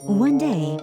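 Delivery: plain reading voice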